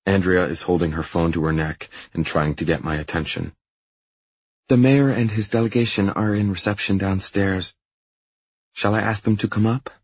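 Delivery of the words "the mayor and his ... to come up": higher-pitched female assistant voice; higher voice for a hesitant assistant; apologetic assistant. Acted. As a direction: report to the boss in a lifted, lighter pitch. Assistant interrupts with news.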